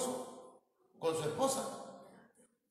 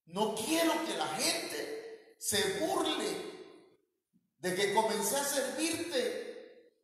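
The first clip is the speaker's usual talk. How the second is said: retold plea, higher and slowed, close to tears; tearful prayer; 5 semitones higher, slower than usual. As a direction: pray it again as you did then, voice near breaking. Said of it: "empecé a llorar y a decirle".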